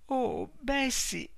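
high, slow, near tears; breaking the news to her sister; tearful. A narrator's reading. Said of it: "si voltò con gli occhi pieni di lacrime". (in lacrime, alta e lenta, alla sorella)

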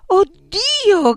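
high, loud scream of horror; piercing exclamation of disbelief; shocked cry. A narrator's reading. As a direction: cry out, aghast. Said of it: "gridò".

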